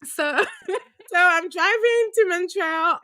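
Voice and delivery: laughing through the words, slow and stretched. Real, not acted. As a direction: giggle as you retell it. Laughing while retelling trip.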